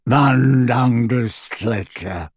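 slow croaking elf voice. Acted. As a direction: croak the name out slowly. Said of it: "croaked the elf".

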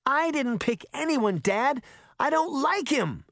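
high-pitched indignant denial; crying out. A narrator's reading. Girl denies to dad that she likes him, crying out. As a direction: protest high, like a mortified daughter. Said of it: "she cried".

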